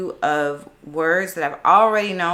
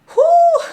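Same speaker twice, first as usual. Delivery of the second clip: high nervous whew; 12 dB louder than usual. Real, not acted. Tense attempt at an answer.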